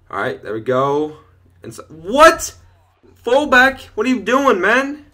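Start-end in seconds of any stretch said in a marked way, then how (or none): 1.9-5.0 high, loud disbelief at a blunder; indignant outcry. Real, not acted. Gamer scolding his own fullback, indignant outcry. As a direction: cry out in exasperated protest, pitched way up.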